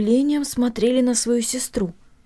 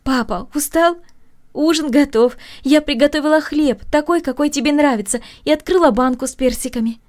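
higher, louder, cheerful; joyful greeting; 6 semitones higher, 4 dB louder than usual. A narrator's reading. Masha greeting her tired father, joyful and lively. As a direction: greet him brightly and gladly. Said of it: "радостно поприветствовала".

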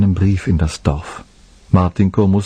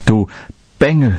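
angry curse; 4 semitones higher than usual. Acted. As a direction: spit the insult at the boy. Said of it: "fluchte die erboste Frau".

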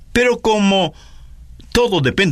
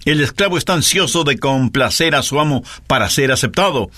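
normal talking voice

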